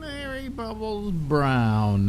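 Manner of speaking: slow, drawn-out guest introduction; announcer intro. Acted. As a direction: announce the guest grandly and slowly.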